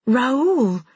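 calling out a name in alarm; sudden anxious cry. A narrator's reading. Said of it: "I cried".

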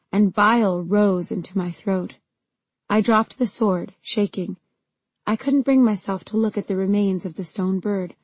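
plain reading voice

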